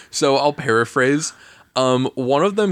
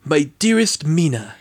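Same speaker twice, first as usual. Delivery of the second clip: mock bad British accent, pitched higher; lightly hammed-up fake British accent; Keanu Reeves British accent; 8 semitones higher than usual. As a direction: ham up a terrible Keanu-style British accent. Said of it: "Keanu Reeves's horrible British accent".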